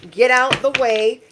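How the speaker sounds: [name] shout. Retold or imitated. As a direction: belt the hook like the rapper. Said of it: "I'm hearing [name]".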